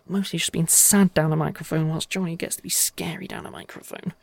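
normal talking voice

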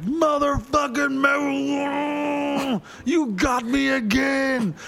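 very high, drawn-out wailing shout; mock anguished outrage. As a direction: curse your nemesis in a hammed-up howl. Mock outrage at weed, retold.

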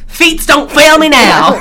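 high, loud, drawn-out cartoon cry; hammed-up wail as if fleeing; mock panicked cry. Acts out fleeing man's cry.

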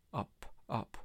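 breathy whispered murmur. Acted. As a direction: whisper it like a secret. Cryptic postcard words murmured.

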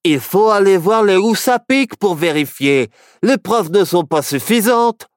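high, loud insistent protest. A narrator's reading. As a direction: push back hard. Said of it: "Barnabé protesta".

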